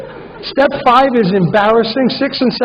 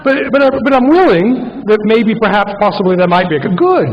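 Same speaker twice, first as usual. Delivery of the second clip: rushed grudging admission. Shown as a demonstration. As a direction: blurt it out reluctantly, then cheer.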